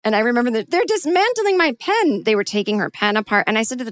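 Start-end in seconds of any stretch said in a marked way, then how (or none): 0.7-2.2 high-pitched aghast protest; hammed-up dismay over a prized possession; imitating outraged owner. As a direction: wail it high, mock-scandalized. Mimics outraged owner of pen.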